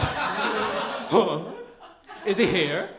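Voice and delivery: high, breathless perked-up voice; eager gossip. Retold or imitated. Crowd in the doorway hearing Bryan Singer's name, eager. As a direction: lean in like a nosy Muppet Baby. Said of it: "It was".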